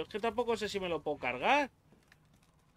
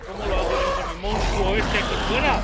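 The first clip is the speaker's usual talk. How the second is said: loud, exasperated outburst at the monster; frustrated shout; 4 semitones higher, 11 dB louder than usual. Real, not acted. Gamer shouting at the monster outside, loud and exasperated. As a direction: yell it out in disbelief.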